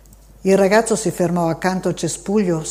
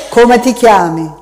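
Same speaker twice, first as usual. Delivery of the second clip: gruff question; 4 semitones higher, 11 dB louder than usual. A narrator's reading. Gruff stranger questions the boy.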